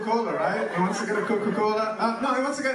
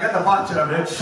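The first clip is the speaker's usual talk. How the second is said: loud mock Iraqi accent; full Iraqi accent; 3 semitones lower, 5 dB louder than usual. Comic imagining Ahmed in bed turning back, full Iraqi accent. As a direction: bark it in a put-on Iraqi accent. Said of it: "you go full Iraqi".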